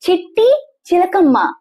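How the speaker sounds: ordinary delivery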